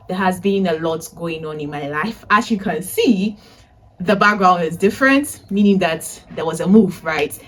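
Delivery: usual speaking voice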